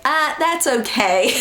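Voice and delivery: high, drawn-out "no thanks" brush-off; petty polite refusal. Retold or imitated. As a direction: decline slowly in a high, avoidant voice. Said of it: "Just so petty".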